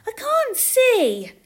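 put-on very high, loud, slow child's complaint; little girl. A narrator's reading. Little girl to her mother in a crowd, childish complaint. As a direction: whine it high like a small child. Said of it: "said a little girl".

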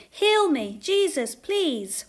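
high, loud pleading; calling out. A narrator's reading. Lame man begging Jesus to heal him, calling out. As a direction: plead loudly for help. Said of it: "called a lame man".

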